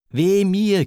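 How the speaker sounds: high, pained exclamation; distressed yielding. A narrator's reading. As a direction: cry out as if cornered. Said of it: "gewährte sie in der Bedrängnis Not". (bedrängtes Wehklagen)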